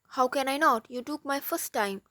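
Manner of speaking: usual speaking voice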